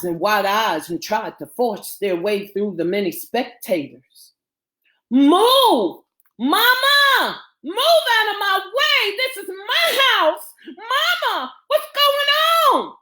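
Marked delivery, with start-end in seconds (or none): 5.1-13.0 very high panicked scream. A narrator's reading. Girl fighting through a crowd to her mama, screaming. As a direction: scream your way through, drawn out and frantic. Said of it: "Camille screamed".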